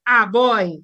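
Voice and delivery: put-on "Ah, boy" with an open ah for the article; Granby pronunciation. Shown as a demonstration. Linguist recalls what she hears, Granby pronunciation. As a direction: open the first word of "Ah, boy" to ah. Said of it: "go to Granby and I hear".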